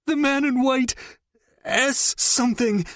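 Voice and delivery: high, strained through pain; pained blurt. Acted. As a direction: blurt it out in agony. Captive gasps out a name.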